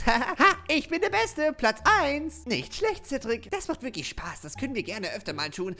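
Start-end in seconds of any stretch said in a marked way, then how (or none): 0.0-2.4 high, loud laughing brag; gloating laugh. Acted. Cedric bragging after his goal, laughing and loud. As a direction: crow about winning.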